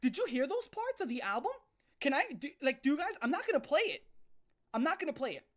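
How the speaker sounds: ordinary talk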